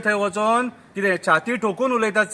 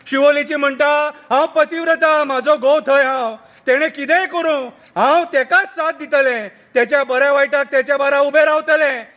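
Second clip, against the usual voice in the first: satirical poem recital; 6 semitones higher, 5 dB louder than usual. Acted. Reciting the turncoats' confession to friends, declaimed verse.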